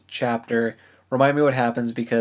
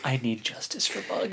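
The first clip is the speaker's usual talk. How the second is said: whispered plea for justice; hushed, mock-grave demand; whispered vow; 7 dB quieter than usual. Real, not acted. Mock-solemn vow for character.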